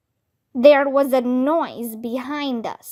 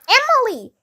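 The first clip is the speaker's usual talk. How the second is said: urgent shout to a friend; 10 semitones higher, faster than usual. A narrator's reading. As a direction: call her over quickly. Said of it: "Andrea shouted".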